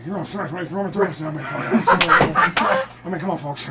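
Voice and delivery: fast, garbled comic mumble; exaggerated hurried, indistinct impersonation; Colin Quinn. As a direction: mimic a mumbling comedian, rushing the words. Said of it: "in his own Colin way".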